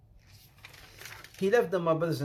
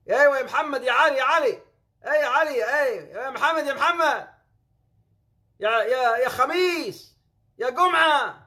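calling out; 8 semitones higher, 7 dB louder than usual. Acted. Calling out to Egyptian friends.

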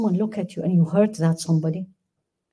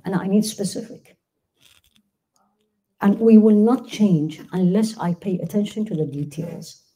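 ordinary talk

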